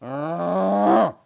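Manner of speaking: short closed-mouth warning hum; a bull elephant's warning grunt; mock elephant grunt. Preacher imitates the bull elephant warning, mock grunt. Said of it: "he goes".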